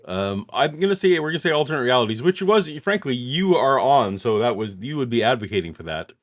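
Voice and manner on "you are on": usual speaking voice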